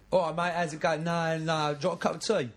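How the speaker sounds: light Cockney builder's greeting; a hint of Cockney, matey to a builder; friendly Cockney patter offering tea; Cockney accent. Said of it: "it's the same with builders".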